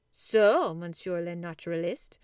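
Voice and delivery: teasing, with a playful lilt on So; impish. A narrator's reading. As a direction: poke fun at the professor lightly. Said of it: "in his somewhat impish tone of voice".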